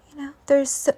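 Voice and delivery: ordinary delivery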